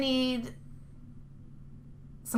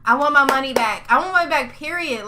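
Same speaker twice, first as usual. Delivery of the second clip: louder, insistent demand; angry demand; 2 semitones higher, 7 dB louder than usual. Real, not acted. Cheated fan wants a refund from Telltale, angry demand. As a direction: demand it forcefully, no room for argument.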